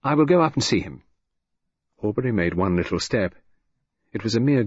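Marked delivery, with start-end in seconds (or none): 0.0-1.0 quick. A narrator's reading. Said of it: "Lydia said quickly".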